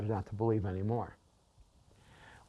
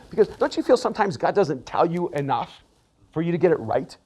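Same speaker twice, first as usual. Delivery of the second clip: ordinary delivery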